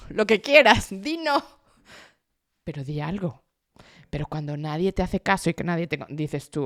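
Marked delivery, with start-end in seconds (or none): 0.1-3.4 exasperated plea. Acted. Speaker urging those who never reply to answer, exasperated plea. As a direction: burst out with mock exasperation.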